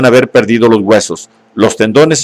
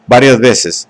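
usual speaking voice

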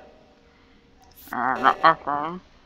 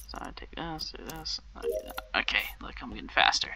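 whispering; 8 dB quieter than usual. Real, not acted. Muttering to self during task.

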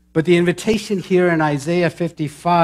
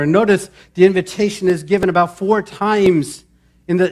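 normal talking voice

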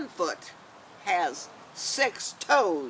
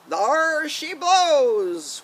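loud, slow lookout call; 6 semitones higher, 6 dB louder, much slower than usual. Acted. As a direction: bellow it slowly from the crow's nest. Lookout calling out a whale.

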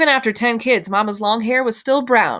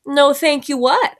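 higher, slow question with rising end; playing dumb; 6 semitones higher, 3 dB louder, slower than usual. A narrator's reading. Child answers Emma's refusal, playing dumb. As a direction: draw out NO, THANK YOU WHAT, feigning puzzlement. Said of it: "I said, playing dumb".

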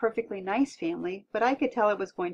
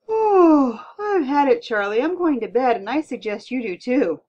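loud exasperated groan easing into firm words; exasperated wife who has had enough; fed up; 5 semitones higher, 10 dB louder than usual. A narrator's reading. Fed-up wife announcing bedtime.

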